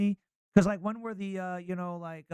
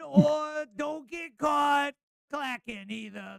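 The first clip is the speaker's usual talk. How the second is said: mock shout; 6 semitones higher, much slower than usual. Wordplay repeat.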